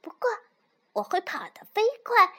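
high, loud, eager little fox; 小狐狸. A narrator's reading. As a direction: boast excitedly as a young fox. Voices little fox in story. (小狐狸兴奋地高声说)

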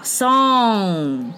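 song very drawn out; slow model of song, ringing on ng; NG sound. Shown as a demonstration. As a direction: stretch song into a long ng. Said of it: "是N G发on的音".

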